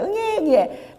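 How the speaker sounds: high, soft mock newborn wail; light playful baby cry; imitated baby cry. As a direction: coo like a crying baby. Imitating the infant Jesus crying.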